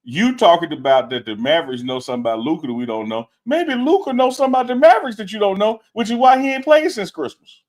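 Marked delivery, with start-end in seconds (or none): 3.5-7.6 much higher, louder, insistent; heated rebuttal. Real, not acted. Co-host countering his partner about Luka and the Mavericks, heated. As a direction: throw the argument back, pitched right up.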